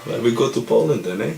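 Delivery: lightly put-on German accent, musing to Hans; a hint of a German general in wartime; mock German officer.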